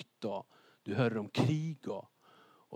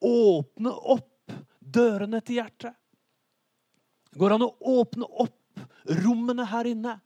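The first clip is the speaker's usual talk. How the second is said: loud, raised, drawn-out appeal; urgent preaching; 10 semitones higher, 7 dB louder, slower than usual. Real, not acted. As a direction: press the appeal, stretching the key words. Preacher urging hearts to open. (inntrengende, høylytt appell)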